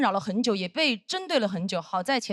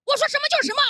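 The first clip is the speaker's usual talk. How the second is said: much higher, louder mock fury; vicious snarl; 14 semitones higher, 4 dB louder, much faster than usual. Senior flight attendant threatening her, vicious mock snarl. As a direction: bark it like a tyrant boss. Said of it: "恶狠狠地说".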